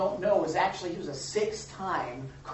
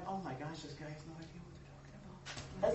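much softer exasperated aside under the breath; muttered inner reaction; 11 dB quieter than usual. Retold or imitated. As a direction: roll your eyes to yourself. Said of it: "and you're going".

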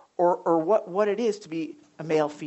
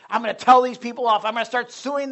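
loud, higher mock zealot; fired up; 4 semitones higher, 6 dB louder than usual. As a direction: imitate a man itching for a fight. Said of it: "he was really fired up".

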